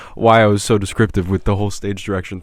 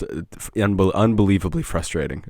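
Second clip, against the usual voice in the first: normal talking voice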